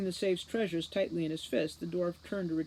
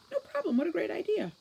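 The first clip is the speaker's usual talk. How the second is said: high, airy gushing delight; gushing, mesmerized; 13 semitones higher than usual. Acted. Mesmerized Juliet gushing to Holly, high and airy. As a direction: sing it out like she's enchanted.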